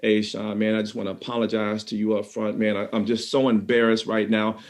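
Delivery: a hint of [name]'s ashamed tremble; trembling embarrassed apology. [name] apologizing to his boss, trembling and ashamed. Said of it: "you can hear the tremble in [name]'s voice".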